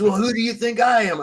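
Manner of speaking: retold shout. Sergeant repeats, louder.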